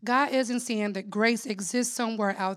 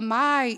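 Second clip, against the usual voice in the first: "my" lifted and louder; stress on my; 2 semitones higher, 3 dB louder than usual. Shown as a demonstration. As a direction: lean on "my". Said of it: "this is God speaking".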